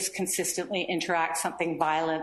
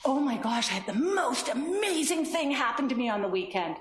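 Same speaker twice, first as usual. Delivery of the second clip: exaggerated excitement, pitched up; put-on gushing amazement; upping the ante; 5 semitones higher than usual. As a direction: hype the news to hook a listener. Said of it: "you sort of up the ante".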